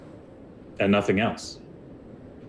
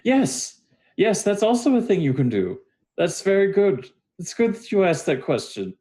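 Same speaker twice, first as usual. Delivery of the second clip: high-pitched put-on character voice; awkward David answering; 12 semitones higher than usual.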